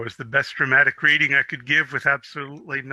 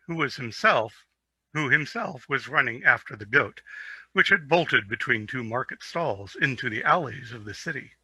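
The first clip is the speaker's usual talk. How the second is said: plain reading voice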